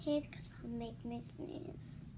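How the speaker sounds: slow hushed whisper; soft whispered read; whispered title. Acted. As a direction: breathe the title out slowly.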